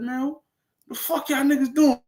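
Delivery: high, disbelieving question; incredulous. Retold or imitated. Streamer amazed at what the truckers are doing, high disbelief.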